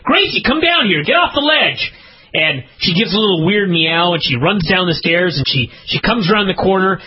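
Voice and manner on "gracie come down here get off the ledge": calling the cat. Retold or imitated. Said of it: "And I'm like".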